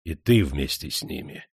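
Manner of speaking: low, gloomy remark; dark, brooding and low. A narrator's reading. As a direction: say it sombrely, pitch dropped. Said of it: "сумрачно сказал Потёмкин".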